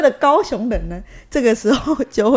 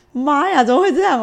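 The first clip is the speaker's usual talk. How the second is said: alarmed gasp; 6 semitones higher, 4 dB louder than usual. Retold or imitated. Retelling seeing friend hit.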